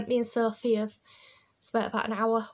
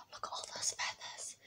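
hushed aside; 15 dB quieter than usual. A narrator's reading. Hushed aside over feathers.

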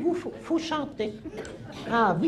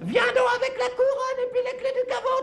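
sarcastic outburst; 5 semitones higher than usual. Acted. Sarcastic retort on the phone.

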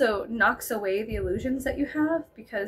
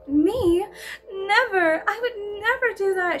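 a light, higher mock denial; innocent denial; 7 semitones higher than usual. Girl denying she gets readings, mock innocent. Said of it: "she would just be like".

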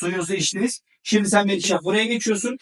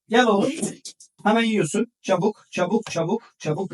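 ordinary delivery

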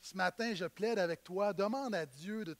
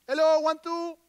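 loud and higher, like testing a microphone; mic-check call; 6 semitones higher, 7 dB louder than usual. Real, not acted. Pastor waking up the congregation, loud mic-check call. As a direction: call out to wake the room. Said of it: "restez avec moi".

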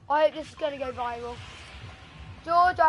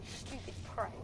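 muttered whisper; 5 semitones lower, 16 dB quieter, faster than usual. Real, not acted. Muttered insult at rival player.

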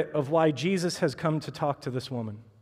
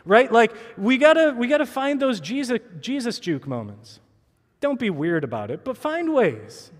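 full-force preaching, pushing louder; rally the congregation like a preacher; fired-up sermon push; 4 semitones higher, 4 dB louder than usual. Acted. Urges the church, rising force.

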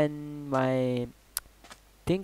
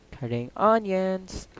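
drawn out, higher, teasing; slow mock crying; 6 semitones higher, 4 dB louder, slower than usual. Slow teasing repeat to viewers, mock crying. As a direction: stretch it out like fake tears.

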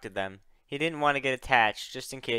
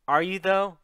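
high, loud sharp challenge; snapped; 6 semitones higher, 7 dB louder than usual. Acted. Luna doubts the assassin's apology, snapping. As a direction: snap the question back. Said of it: "Luna snapped".

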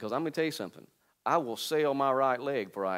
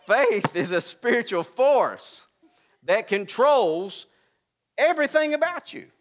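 slow, higher, louder preacher's cry; preaching loud; 12 semitones higher, 11 dB louder, slower than usual. Shown as a demonstration. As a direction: proclaim it slowly with full force. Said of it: "Let me start all over".

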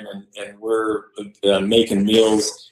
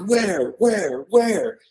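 high, falling cries, repeated; retold urgent searching; 7 semitones higher, much slower than usual. Buddies hunt for the popped-out bear, mock urgency. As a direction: imitate buddies frantically looking around, pitched up.

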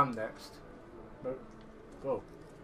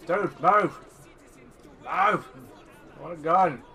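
impatient demand; 3 semitones higher, 7 dB louder than usual. Pretend. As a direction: shout at someone blocking the way. Demanding that game character move aside.

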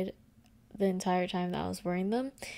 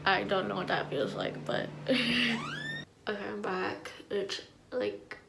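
thin film over teeth; slightly muffled teeth sounds; whitening strips on teeth. Real, not acted. Seen 0: Applied at -2.0; teeth covered, pressed on at 6.8; peeled off at 28.7.

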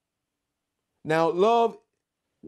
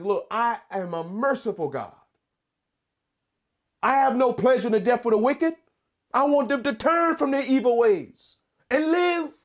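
merciful God; 6 semitones higher than usual. Acted. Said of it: "God says".

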